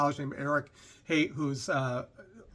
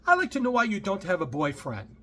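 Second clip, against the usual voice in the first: bad accent impression, higher pitched; Doctor Ruth; 5 semitones higher, 3 dB louder than usual. Passenger asking the driver about a boyfriend, mock accent. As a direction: imitate her accent, raised voice. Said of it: "I'm doing a horrible accent here".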